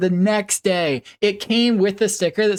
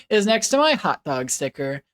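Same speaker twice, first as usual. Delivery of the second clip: ordinary talk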